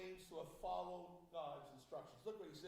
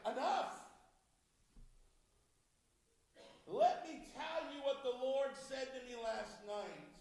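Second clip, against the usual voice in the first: much louder, higher Enough! snapped; sharp shout; 5 semitones higher, 10 dB louder, slower than usual. Retold or imitated. Samuel cuts off Saul's excuses, shouting angrily. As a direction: cut him off with a shout.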